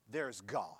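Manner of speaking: ordinary delivery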